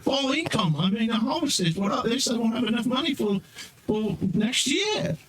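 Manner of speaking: high-pitched fretting lady; Loretta. Acted. Loretta frets to the guard about her apples, high-pitched and fussing. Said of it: "she's kinda the, uh, Loretta, she's kinda like".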